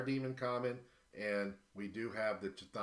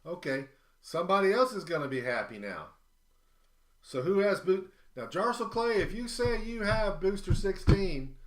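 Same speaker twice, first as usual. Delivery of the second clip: loud, high excited announcing; 6 semitones higher, 8 dB louder than usual. Real, not acted. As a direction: hype up the big win loudly.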